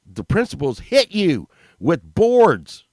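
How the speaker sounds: ordinary delivery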